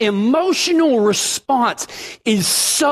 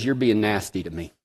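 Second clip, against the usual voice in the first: much softer, lower, wounded; quiet hurt; 13 semitones lower, 7 dB quieter than usual. Retold or imitated. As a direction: say it small and hurt, near tears. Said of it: "She goes".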